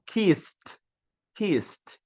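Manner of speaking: "kissed" with hissed s and final t; voiceless S. Shown as a demonstration. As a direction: demonstrate "kissed" as "kist". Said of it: "Dois S normalmente tem som de S".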